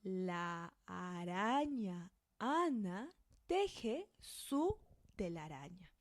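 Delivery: very slow "La araña Ana" with long gaps; slow, spaced out. Shown as a demonstration. Teacher slows down so learners can follow. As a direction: read it word by word, very slowly. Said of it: "Now you try".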